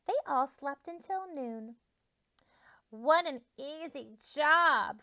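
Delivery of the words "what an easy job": slow, louder smug hen; hen's boast. Acted. Hen boasts about the easy job, slow and louder. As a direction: stretch it out like a pleased hen. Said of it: "said the hen".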